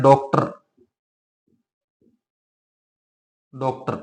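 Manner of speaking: डॉक्टर said with the ऑ of English doctor; example word. Shown as a demonstration. Teacher repeating the example word for students, precisely. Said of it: "जैसे हम कहते हैं".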